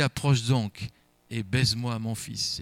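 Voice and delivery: put-on old father asks his son for a kiss, soft and tender; tender request. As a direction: draw the son in gently.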